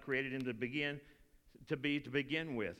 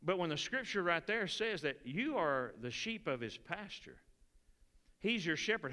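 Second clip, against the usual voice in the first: usual speaking voice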